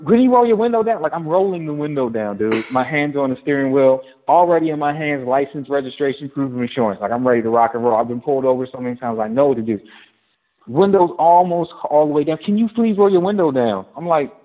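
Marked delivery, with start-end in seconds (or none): 0.1-1.0 a high, sharp, impatient order from a cop; fussy demand. Retold or imitated. Officer nagging a driver at a traffic stop, high and impatient. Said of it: "fussed at me the entire time". 12.4-13.9 higher, pointed please, impatient; fussy demand. Retold or imitated. Officer repeating herself to a driver already complying, impatient. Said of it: "fussed at me the entire time".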